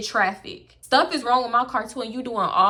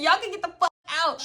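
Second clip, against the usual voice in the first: higher mock yell; 8 semitones higher, faster than usual. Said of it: "I'm like".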